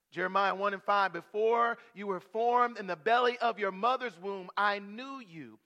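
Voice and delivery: full-force preaching. Acted. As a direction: proclaim each verse to the congregation.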